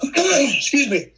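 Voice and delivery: hoarse after clearing throat; rough, slow, dry-throated voice. Real, not acted. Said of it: "it's getting dry in here".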